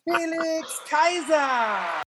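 drawn-out high boxing ring shout; ring announcer. Acted. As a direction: bellow the name like a fight announcer. Introducing guest like ring announcer.

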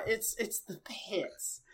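breathy whisper, slow; whispered, drawn-out resignation. Real, not acted. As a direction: let it fade to a whisper. Defeated comment after laughing.

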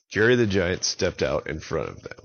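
plain reading voice

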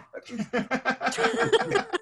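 high burst of laughter; laughing. Real, not acted. Laughs at his own memory.

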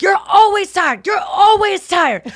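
high, loud, slow mock shout of complaint; imitating Donnell scolding Nikki for being tired. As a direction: belt it like an exasperated friend. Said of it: "He's like".

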